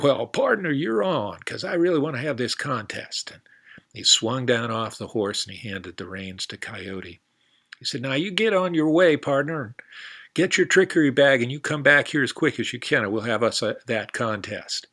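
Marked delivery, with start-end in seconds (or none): 0.0-3.3 higher, louder cowboy voice; eager cowboy, higher and louder; hearty cowboy. Acted. Cowboy character's lines. 8.0-14.9 higher, louder cowboy voice; eager cowboy, higher and louder; hearty cowboy. Acted. Cowboy character's lines.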